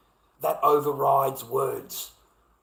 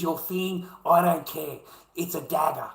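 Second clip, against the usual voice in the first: usual speaking voice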